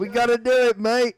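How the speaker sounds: loud, high hammed-up Australian; bad Australian accent. Voicing Jake to his friend, loud mock Australian. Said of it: "my Australian accent impression".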